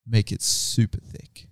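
slow, hushed, leaning-in mock sultry line; ASMR-style imitation. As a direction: drag each word out like a creepy ASMR customer. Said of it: "I'm gonna do it for the A S M R of the video".

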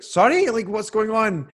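baffled, asking what just happened; confused surprise. Retold or imitated. She is baffled as the woman starts crying, high and confused.

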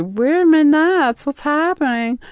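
put-on high, bewildered dopey kid; confused teen voice. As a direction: squeak it out like a lost, confused kid. Friend voiced confused in story.

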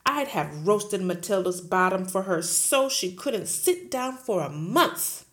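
loud, booming headmistress; bully the teacher at full volume; Trunchbull bullying. Acted. Headmistress roaring at Miss Honey, loud and bullying.

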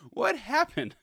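high, loud, baffled; mock bewilderment. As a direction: play the confused receiver. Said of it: "This guy was just like".

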